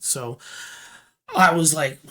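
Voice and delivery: plain reading voice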